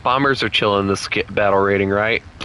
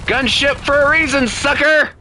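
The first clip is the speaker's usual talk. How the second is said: taunting shout; 13 semitones higher than usual. Real, not acted. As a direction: yell it at the enemy. Taunting enemy plane in game.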